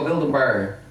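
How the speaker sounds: put-on Saint Louis accent, "Build a burr" with bear said as "burr". Showing friends the Saint Louis accent, mock "burr". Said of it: "that's the accent".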